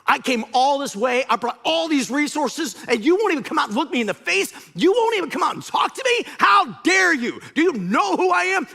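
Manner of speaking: high, loud outburst of indignation; livid. Acted. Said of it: "And Naaman's livid".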